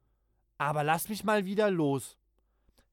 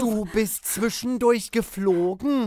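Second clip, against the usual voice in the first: accusing; 5 semitones higher, 7 dB louder than usual. Acted. Children quarrel loudly.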